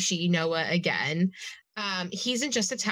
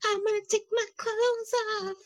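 squeaky high tipsy voice; high drunk girl voice; 12 semitones higher than usual. Retold or imitated. Drunk Elle mimicked high.

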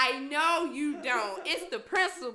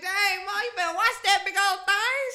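loud, raised-pitch teasing holler; teasing exclamation; 6 semitones higher, 8 dB louder than usual. Retold or imitated. Daughter teasing her mama as she comes around, hollered. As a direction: call it out in playful amazement.

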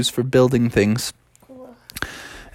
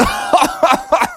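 high mocking bear laugh; a high laugh at a silly idea. Dad bear laughs off the monkey story, mocking.

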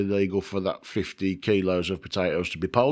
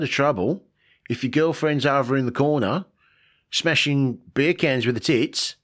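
a higher, hammed-up Australian accent; 5 semitones higher than usual. Vlogger warning viewers in a mock Australian accent. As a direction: play an Aussie bloke warning tourists. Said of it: "I'm gonna stop doing the accent now".

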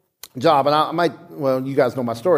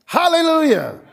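shouted hallelujah; 8 semitones higher than usual. Real, not acted. Preacher shouting praise at the sermon's climax. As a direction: lift it as praise.

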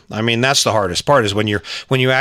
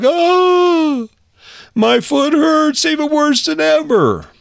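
put-on very high, drawn-out child's wail; hammed-up bawling kid; mock wailing; slower than usual. Son to his father after kicking again, mock wailing. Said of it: "Now he's just wailing".

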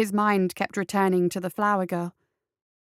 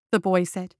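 ordinary delivery, nothing marked

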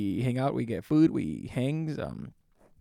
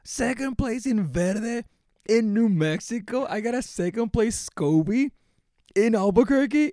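much higher, louder, thrilled disbelief; ecstatic outburst; 8 semitones higher, 4 dB louder than usual. Retold or imitated. Retelling the moment he learned he placed second, thrilled. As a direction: burst out like you just won big. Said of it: "I was ecstatic".

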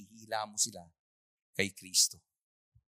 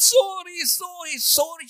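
high, loud, tearful begging; wife pleading with her husband through tears; pleading sorry; 12 dB louder, slower than usual. Acted. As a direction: beg forgiveness, voice breaking. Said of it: "Sabi niya".

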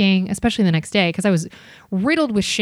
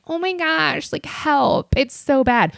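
high fake-helpless plea; helpless girl voice; 7 semitones higher, slower than usual. Comic imitates girl begging security for help, high and helpless. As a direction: play the victim, pitched up. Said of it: "the girl that was doing the".

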